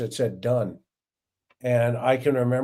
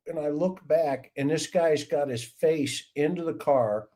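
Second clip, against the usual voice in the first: ordinary delivery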